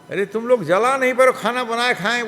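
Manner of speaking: high, loud teasing of the clumsy cooks; teasing maid. Acted. Maid teases the brothers for failing at the fire, high and loud.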